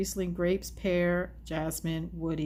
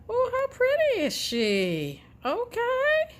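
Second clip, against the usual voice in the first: high squeal of delight; cooing; 5 dB louder, slower than usual. Real, not acted. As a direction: coo over it, way up high. Cooing over a pretty card.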